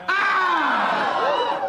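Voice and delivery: high, loud tennis grunt shout; Serena Williams grunt. Retold or imitated. Comic squeezing through a racket, loud tennis grunt. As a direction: let out a big tennis-serve grunt. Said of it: "It's my Serena Williams impression".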